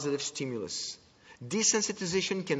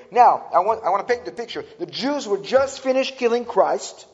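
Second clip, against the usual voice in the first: preaching pastor; 6 semitones higher, 8 dB louder than usual. Retold or imitated. Senior pastor preaching, retold.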